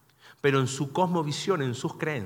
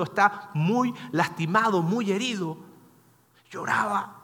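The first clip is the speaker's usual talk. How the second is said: usual speaking voice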